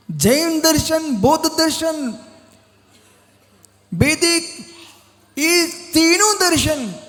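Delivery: high, proclaiming voice with long pauses; declamatory preaching. Real, not acted. Monk telling of Emperor Karkandu, declamatory preaching. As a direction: proclaim each phrase high and separate.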